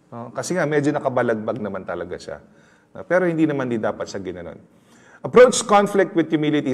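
ordinary talk

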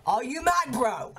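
shouted at the game, high; loud. Real, not acted. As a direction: yell it in disbelief. Shouts same question at game.

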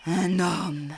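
a lightly voiced father wolf, calling out sharply; sudden call. A narrator's reading. Father wolf spots the child, calls out sharply. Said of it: "lança-t-il soudain".

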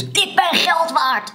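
high, slow, hammed-up card voice; lightly comic shouting trading card; mock yelling card. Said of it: "en tegen je schreeuwt".

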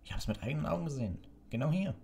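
rapid, high-pitched furious protest; enraged. A narrator's reading. Executioner insisting he saw it tied there, enraged. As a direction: insist angrily, fast and high. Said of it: "sagte der Henker wutentbrannt".

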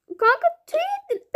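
mocking tease. Prankster gloating that her friend ate feathers, teasing.

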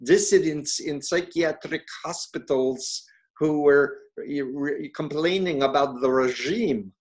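lightly held bad Russian accent; fading Russian accent. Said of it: "I'm gonna do a bad Russian accent now".

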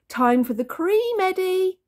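loud sing-song rising to the name; cheery call. A narrator's reading. Dad calls baby for cream, cheery sing-song.

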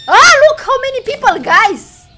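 high, loud and fast; thrilled at the crowd; loud excited call to the chat; excited shout. Real, not acted. Crowd arrives.

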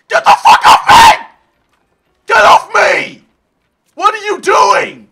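shouting. Real, not acted. Goat rider won't let go.

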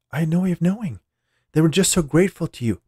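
high, pleading woman's voice; acted fretful self-defense; anxious pleading. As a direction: plead your excuse to the boss, pitched well up. Employee pleads with angry boss.

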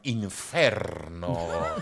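INFERNO drawn out slowly in mock Italian; showy Italian accent. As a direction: suddenly go full Italian, very slow and low. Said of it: "they say it in perfect Italian or French".

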